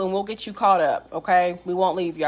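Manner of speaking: ordinary delivery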